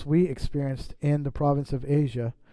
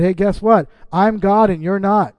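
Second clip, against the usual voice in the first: bold, raised voice, almost proclaiming; emphatic declaration; 3 semitones higher, 6 dB louder than usual. Retold or imitated. Pastor voicing God to his congregation, bold and loud.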